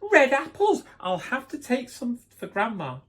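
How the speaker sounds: high, loud, delighted shout; excited boy calling out. Acted. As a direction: cry it out like a thrilled kid spotting treats. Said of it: "called out excitedly".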